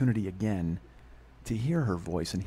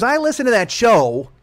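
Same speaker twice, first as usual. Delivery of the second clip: loud, high, slow imitation of mom; mock maternal confrontation; reproachful mother; 11 semitones higher, 10 dB louder than usual. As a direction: voice mom's accusation high, loud and drawn out. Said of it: "She goes".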